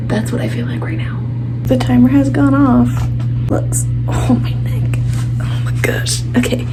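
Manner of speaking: excited hushed whisper; frantic whisper. Real, not acted. Still whispering, parents asleep.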